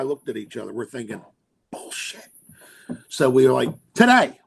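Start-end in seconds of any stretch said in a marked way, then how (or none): 1.7-2.3 a breathy under-the-breath whisper; whispered thought. Retold or imitated. Thinking it while the supplier talks, whispered aside. 3.9-4.4 eager blurt. Retold or imitated. Telling the supplier they need them now, eager loud blurt.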